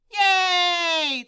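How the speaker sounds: loud high cheer. Acted. Crowd cheering the rescue, loud cheer. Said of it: "the crowd cheered".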